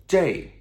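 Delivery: "J" like English "jay", high and falling; colloquial pronunciation. Shown as a demonstration. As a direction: punch out "J" as a loud falling jay. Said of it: "usually pronounced as J".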